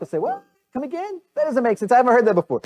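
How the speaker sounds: high-pitched, incredulous mock reaction; mock bafflement. Listeners react to Jesus' puzzling teaching, mock bafflement. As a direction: squeak the doubt, then ease down.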